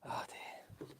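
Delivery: soft breathless whisper; whispered oh dear. Real, not acted. Whispered to self.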